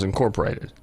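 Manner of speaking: ordinary talk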